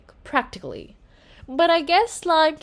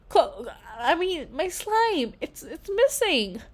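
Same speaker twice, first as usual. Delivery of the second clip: softer, faltering and tearful; near tears; 6 semitones higher, 4 dB quieter than usual. A narrator's reading. Girl telling her parents her slime is missing, near tears. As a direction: stumble over the words, close to crying.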